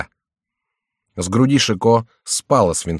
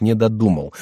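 usual speaking voice

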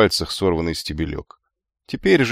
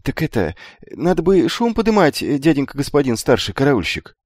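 high-pitched, hesitant youngster; boyish, flustered high voice; anxious youth; 7 semitones higher than usual. A narrator's reading. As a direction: timidly urge an alarm. Nervous youth urges raising the alarm.

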